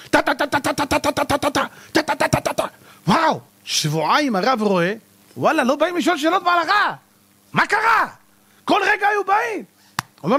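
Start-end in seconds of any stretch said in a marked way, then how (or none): 0.1-2.6 high, even staccato syllables; rattled-off ta-ta-ta. Retold or imitated. Rabbi firing off rulings, rattled-off ta-ta-ta. Imitates rapid run of rulings. 5.4-9.6 high-pitched puzzled wondering; acted bafflement. Rabbi wondering why nobody comes to ask, high bafflement.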